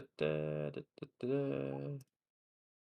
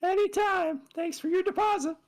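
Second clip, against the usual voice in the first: high squirrel voice; 5 dB louder than usual. Acted. Squirrel answers.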